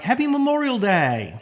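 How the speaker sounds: imitating a bright high holiday greeting; mock chirpy, high sing-song wish; cheery greeting. Imitating folks wishing him a cheerful holiday, high and chirpy. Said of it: "Folks are always like".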